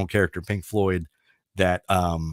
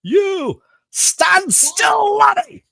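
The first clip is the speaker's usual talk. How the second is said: high, slow, loud Scottish yell; mock Scottish schoolmaster shouting; Scottish accent shout; 6 dB louder, much slower than usual. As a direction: bellow it like an angry Scottish teacher. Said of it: "he has a Scottish accent".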